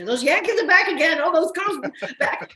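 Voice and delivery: mock local girls calling out, with a laugh; local girls teasing. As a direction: mimic the local girls ribbing the northerners. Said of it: "Those girls would say".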